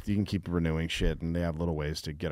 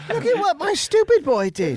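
very high, loud mother voice; mock shrieking mom; 10 dB louder than usual. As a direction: show off the boy like a shrill mom. Mocking proud mother.

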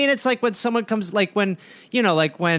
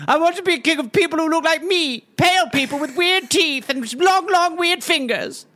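higher, plaintive mock royal whine; whining prince; 6 semitones higher than usual. Young Charles whining to his mother, petulant high voice. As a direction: play a spoilt prince pleading his case.

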